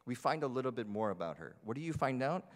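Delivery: ordinary delivery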